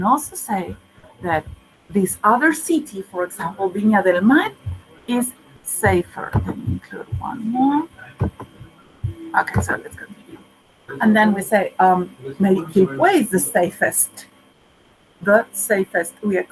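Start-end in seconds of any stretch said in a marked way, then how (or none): 6.3-10.4 whispered aside. Real, not acted. Aside while teaching.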